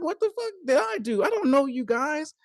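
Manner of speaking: high incredulous what-the-hell; exasperated disbelief. Retold or imitated. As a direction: throw up your hands, what did I do. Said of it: "And I'm just like".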